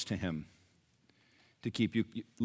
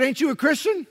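much higher put-on voice of another patient; blunt challenger; 6 dB louder than usual. Fellow patient confronting sour-faced Nelson, blunt high-voiced challenge. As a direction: challenge him in a high, acted voice.

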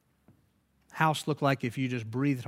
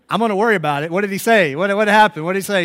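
louder, higher, urgent repeated questioning; loud insistent demand; 5 semitones higher, 12 dB louder, faster than usual. Retold or imitated. Pressing the translator who won't tell him, loud and insistent. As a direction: demand an answer at full voice. Insists on hearing what was said.

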